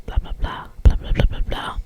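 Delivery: ordinary delivery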